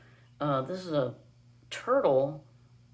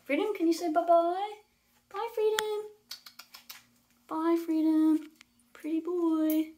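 high-pitched bird talk; 10 semitones higher than usual. Real, not acted. Owner greeting her parrot Freedom in high-pitched coo. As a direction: coo up high like talking to a pet. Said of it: "He does enjoy a high-pitched voice".

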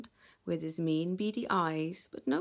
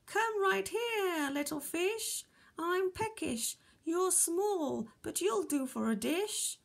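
high, sing-out whale voice; high storybook giant relishing a meal; booming whale; 8 semitones higher than usual. A narrator's reading. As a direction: call it out as a hungry whale, pitched up. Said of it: "boomed the whale".

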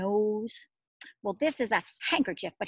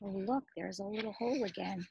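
normal talking voice

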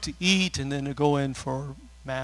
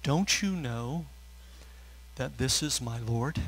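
tender rebuke; 3 semitones lower, 9 dB quieter than usual. Retold or imitated. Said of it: "she said".

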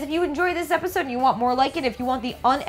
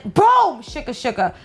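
ordinary talk, nothing marked

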